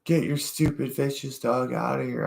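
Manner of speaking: high-pitched mock anger at normal volume; mad lady impression. Retelling the lady who called the dog stupid, high mock-angry voice. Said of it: "That lady was so mad".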